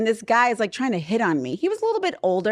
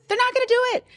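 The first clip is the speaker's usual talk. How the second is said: much higher, insistent; emphatic warning; 14 semitones higher, much faster than usual. Real, not acted. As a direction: drive it home.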